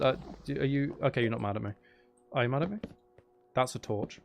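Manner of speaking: ordinary talk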